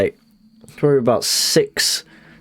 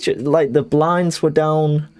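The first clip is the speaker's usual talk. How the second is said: ordinary delivery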